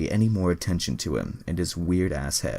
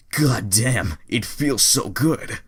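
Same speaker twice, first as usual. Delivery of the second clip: higher and louder, stretched out slowly; ecstatic; 5 semitones higher, 6 dB louder, slower than usual. Acted. Two characters thinking in unison, hammed-up ecstatic exclamation. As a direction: let it burst out, drawn long.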